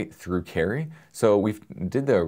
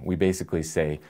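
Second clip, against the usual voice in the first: ordinary delivery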